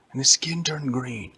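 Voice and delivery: plain reading voice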